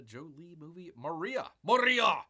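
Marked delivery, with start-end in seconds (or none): 1.6-2.2 much higher, much louder echo; mock-grand shout. Host hamming up a film title, mock-grand shout. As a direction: belt the name out in mock grandeur.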